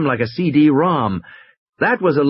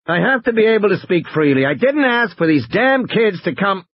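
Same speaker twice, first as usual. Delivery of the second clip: angry; 9 semitones higher than usual. Acted. Gennaro angry over intercom.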